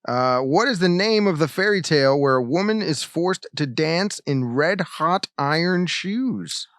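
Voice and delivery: ordinary talk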